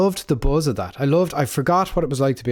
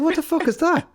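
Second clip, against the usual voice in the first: exasperated outburst. Retold or imitated. Neighbor baffled by the farmer's answer, squawking high. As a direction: blurt it out in comic outrage. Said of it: "I'm like".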